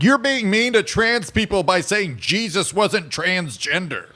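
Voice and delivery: high, whiny mimicked complaint; mock offended woman. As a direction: imitate an indignant drunk heckler. Voicing the upset drunk woman.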